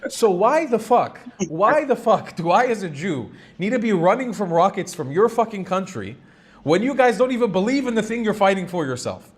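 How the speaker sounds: ordinary talk, nothing marked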